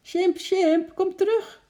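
high, loud alarmed cry; anxious shout. A narrator's reading. Lotje calling the runaway chimp back, anxious shout. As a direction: call desperately after someone running away. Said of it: "riep Lotje angstig".

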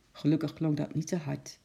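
plain reading voice

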